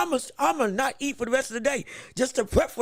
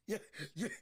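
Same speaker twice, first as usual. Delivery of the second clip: nervous laugh; 19 dB quieter than usual. Real, not acted. Nervous laugh after spider scare.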